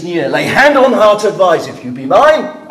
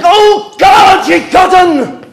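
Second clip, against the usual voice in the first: very high, loud outburst; furious shout; 10 semitones higher, 4 dB louder than usual. Acted. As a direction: blow up at her. Father explodes at nurse.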